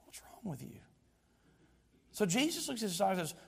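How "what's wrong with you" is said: whispered question; God. Acted. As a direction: whisper it in imitation of a puzzled God. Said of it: "And God's like".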